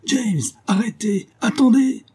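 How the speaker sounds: high woman's cry from afar, drawn out; shouted plea. A narrator's reading. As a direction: call out desperately to stop him. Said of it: "Cette supplication avait été criée par une femme". (supplication criée, voix de femme aiguë)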